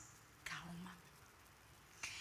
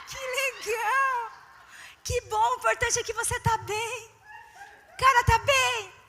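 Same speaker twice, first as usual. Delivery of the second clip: 1 forced cheer; 7 semitones higher, 4 dB louder than usual. Retold or imitated. Ironic forced cheer to husband.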